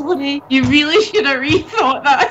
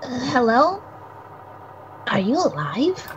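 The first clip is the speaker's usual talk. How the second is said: lightly voiced young boy, nervous and guilty; a hint of a jittery spirit boy who fears he killed someone; young boy character. Friend voicing the visual novel's boy, lightly nervous. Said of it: "Coco, please, please take it away".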